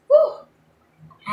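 high, loud whoop; a thrilled whoop at the cool air; excited woo. Real, not acted. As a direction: let out a delighted cry. Mom reacts to the air fan.

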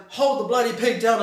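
loud mock yell at Ivan; dad yelling at Ivan to hold the pig, loud mock yell; retold yell. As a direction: bark it like an annoyed dad. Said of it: "yelling at Ivan".

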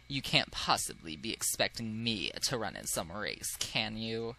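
low-voiced haughty noble; haughty aristocrat.

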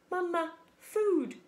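put-on high, slow baby-bird plea; hungry gosling. A narrator's reading. Gosling asks the staring robot for a meal, peeping high and slow. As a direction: draw it out like a pleading chick. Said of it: "said the gosling".

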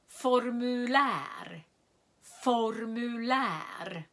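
Formulär slowly, long ä; careful repeated demo; slow model word. Shown as a demonstration. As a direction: stretch Formulär for learners. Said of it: "lyssna på ljudet om jag säger lång vokal".

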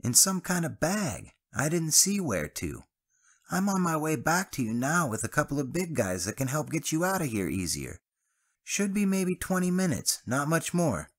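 put-on cheerful young Travis, cut off mid-word; Travis's voice. A narrator's reading.

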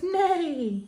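high playful neigh; whinny like a horse; a child's play horse neigh; mock horse neigh. Animal sound in farm rhyme.

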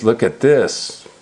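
ordinary delivery, nothing marked

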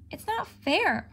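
high, sulky whine; pouting. A narrator's reading. Cat pouting to Toby about the missing stew, sulky. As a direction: pout like a let-down kitten. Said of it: "I pouted".

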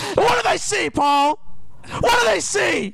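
hammed-up high shouting fury; outraged rant. Scolding Paul about spam videos, mock outrage. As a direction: build into a loud, high-pitched mock tirade.

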